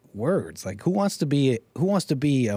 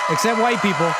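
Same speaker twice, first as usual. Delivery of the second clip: snide high aside; sarcastic quip; 6 semitones higher, 4 dB louder than usual. Acted. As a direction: toss it off mockingly. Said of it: "I quipped".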